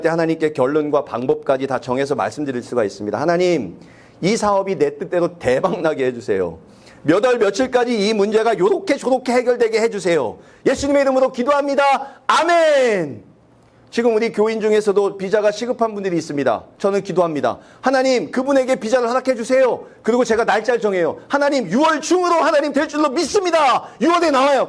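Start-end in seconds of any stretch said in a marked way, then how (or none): 3.2-13.2 a hammed-up, high self-serving prayer with a laugh; mock prayer. 17.8-24.7 high, insistent prayer setting the date; mock prayer. Pastor mock-praying date.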